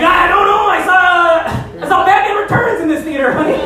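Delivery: high, loud, half-laughing yell; mock shout. Husband loudly defending the proposal spot to his wife, mock shouting. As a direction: ham up the clueless husband. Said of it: "You're like".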